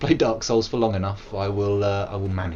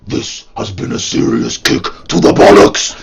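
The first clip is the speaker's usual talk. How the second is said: growled death metal voice; 6 semitones lower, 10 dB louder than usual. Pretend.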